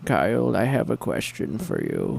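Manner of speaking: slow put-on villain voice; slow, menacing movie-villain questioning; Bane impression. Host asks Kyle a question, in mock villain voice.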